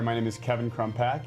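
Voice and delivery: normal talking voice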